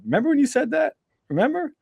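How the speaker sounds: put-on high, sing-song ribbing of an old friend; playful teasing. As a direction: tease him like you've told this joke for years. Teasing old friend about his warning.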